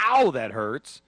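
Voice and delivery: loud mock "ow" of pain; acted ouch, punched at the start; pained yelp. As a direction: yelp as if something suddenly hurts. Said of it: "just me walking around going".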